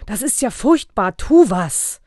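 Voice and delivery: alarmed urging. A narrator's reading. Wife appalled at the invasion, urgently pleading. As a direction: urge him to do something now. Wife urges husband to act.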